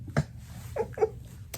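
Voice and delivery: breathy high-pitched giggle. Real, not acted. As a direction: let a squeaky giggle slip out. Giggling while telling the story.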